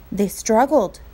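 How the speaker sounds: plain reading voice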